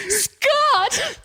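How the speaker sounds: high, drawn-out mock swooning call; hammed-up dramatic entrance. Lost love walking in on Scott, theatrically drawn out. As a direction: call the name like a long-lost love appearing.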